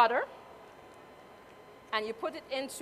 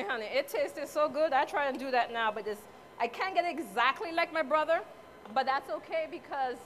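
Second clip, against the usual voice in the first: normal talking voice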